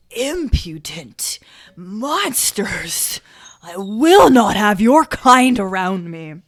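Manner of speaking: raspy whisper rising to a roar; old priest's rage. Acted. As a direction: spit it out like a furious ancient priest. GM voices high priest.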